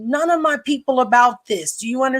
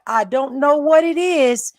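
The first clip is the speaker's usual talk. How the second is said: ordinary delivery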